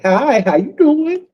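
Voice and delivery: high, slow, sing-song greeting; flirty hello. Retold or imitated. Greeting the ladies on their channels, high and slow. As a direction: stretch it out sweetly to charm her.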